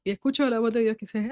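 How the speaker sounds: usual speaking voice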